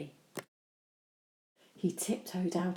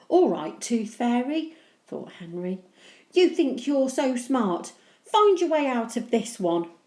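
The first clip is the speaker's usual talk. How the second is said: taunting; 6 semitones higher, 8 dB louder than usual. Acted. Henry challenging fairy.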